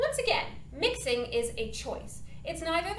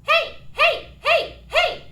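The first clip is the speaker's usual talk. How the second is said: high heys, louder and less airy with chest mixed in; stronger, not as airy mix; 12 dB louder, slower than usual. Shown as a demonstration. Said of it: "mixing a little bit of my chest voice".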